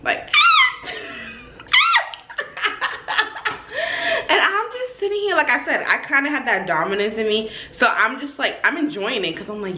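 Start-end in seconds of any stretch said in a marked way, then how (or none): 0.2-4.2 high mock screams breaking into laughter; hammed-up scream with laughing; imitated screaming. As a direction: yelp high, then cackle. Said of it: "screaming at this point".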